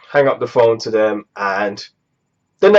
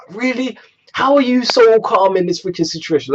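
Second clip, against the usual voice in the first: higher, incredulous exasperated retort; can't believe he's this calm, pitched up; exasperated outburst; 8 semitones higher than usual. Retold or imitated. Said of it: "I was losing my ish".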